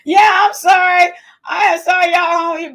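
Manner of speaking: laughing loudly, apologising to Tim; loud laughing apology. Real, not acted. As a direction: call out the sorry with a laugh. Laughing apology to Tim's joke.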